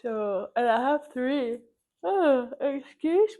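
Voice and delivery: talking through a real yawn; slow, loud, yawn-stretched words. Real, not acted. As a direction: let a big yawn swallow the words. Yawns mid-sentence, excuses herself.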